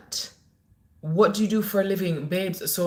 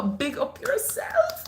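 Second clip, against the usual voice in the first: much higher, slow, stretched-out shout-out; sing-song shout-out; slower than usual. Real, not acted. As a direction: call it out to her like a radio DJ. Shout-out to a viewer.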